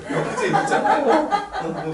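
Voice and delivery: slow, laughing, high and loud. Real, not acted. Preacher laughing with the listeners. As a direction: laugh the words out slowly.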